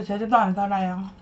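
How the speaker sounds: usual speaking voice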